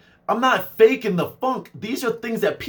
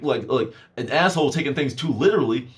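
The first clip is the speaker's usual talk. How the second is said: ordinary talk, nothing marked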